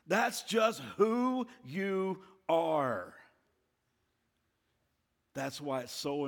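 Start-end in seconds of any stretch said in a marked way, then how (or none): 0.1-3.1 very slow, pitched-up verdict; condemning accuser. Acted. Satan tells us who we are, slowly condemning. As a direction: draw out each word as a final judgement. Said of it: "And he says to us".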